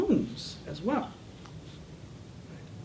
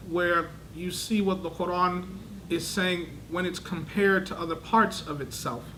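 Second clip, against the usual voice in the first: ordinary talk, nothing marked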